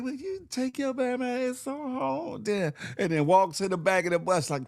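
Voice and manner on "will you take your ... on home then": high, slow mock-offended sass; mocking woman's voice. Woman on the bus snaps at the driver, high mock sass. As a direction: play her huffy and high. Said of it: "She like".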